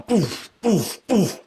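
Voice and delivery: soft puffing poof for each flash; breathy sound effect, poof; imitated poof. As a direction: puff out little bursts of air.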